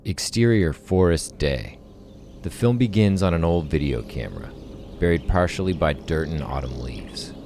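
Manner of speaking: measured, dramatic playback read; script narration. Acted. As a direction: read the screenplay aloud with weight and pauses.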